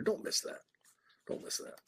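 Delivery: hushed whisper, almost to himself. Real, not acted. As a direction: breathe the words as a quiet plea. Coach urging the shooter, whispered.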